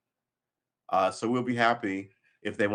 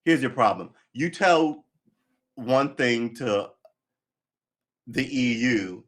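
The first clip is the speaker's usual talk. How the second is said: louder and higher, slowing right down on "one thing to"; loud rant; 4 semitones higher, 8 dB louder, slower than usual. Real, not acted. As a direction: hammer the point home to the viewers. Ranting about EU leaks.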